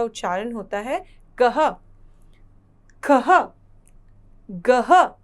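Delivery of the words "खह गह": खह and गह said loud, a breathy ह after each; clear demo. Shown as a demonstration. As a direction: call out each syllable to be copied. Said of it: "ग के साथ अह की मात्रा गह". (खह, गह ज़ोर से साफ़-साफ़)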